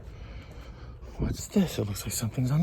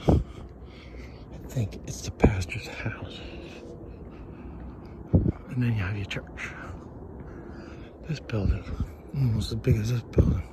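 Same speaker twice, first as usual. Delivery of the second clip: whisper; 3 semitones lower, 5 dB quieter than usual. Real, not acted. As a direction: lean in and whisper the secret. Gossiping about pastor's house.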